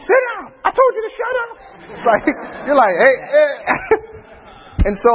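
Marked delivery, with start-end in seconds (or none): 0.0-1.5 high-pitched mock yell; parent going off. Parent going off on the kids, high mock yelling. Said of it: "hearing one parent go off on the kids". 3.0-3.5 high cautioning call; interjecting. Retold or imitated. One parent checking the other, high interjection.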